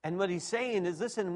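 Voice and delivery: ordinary talk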